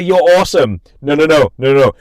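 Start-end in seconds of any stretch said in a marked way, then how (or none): 0.0-0.8 higher, louder cheer; peppy "You're awesome"; bright "you're awesome". Shown as a demonstration. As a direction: toss off a quick upbeat compliment. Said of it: "Maybe that".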